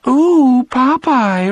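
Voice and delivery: high, breathless cartoon swoon; swooning Olive Oyl. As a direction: coo it like a cartoon girlfriend. Olive's cartoon speech balloon read out.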